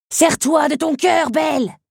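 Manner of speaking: high, loud urgent cry; shouted urging. Acted. Scarab urges Belle to use her heart, shouting. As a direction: yell it to get through to her. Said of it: "lui cria-t-il".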